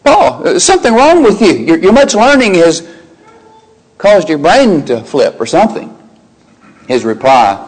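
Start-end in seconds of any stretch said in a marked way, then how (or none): 0.0-6.0 a governor scolding a man he thinks crazy; hammed-up scornful ruler; scoffing Festus. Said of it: "Festus speaks up".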